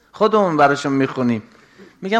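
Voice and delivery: normal talking voice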